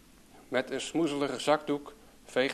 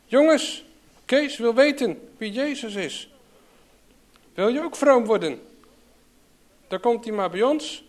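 loud, high jeering to the whole group; mocking shout; 7 semitones higher, 7 dB louder than usual. A narrator's reading. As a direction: make fun of Kees in front of the lads. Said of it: "En ze hadden er flink de spot mee gedreven". (luid en hoog, spottend roepend naar de jongens)

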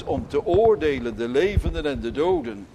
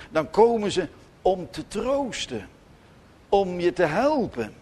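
normal talking voice

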